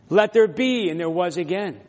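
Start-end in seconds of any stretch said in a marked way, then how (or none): 0.1-0.9 higher, louder declaration; divine command. Acted. Preacher repeats God's creating word, loud and raised. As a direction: proclaim it with authority.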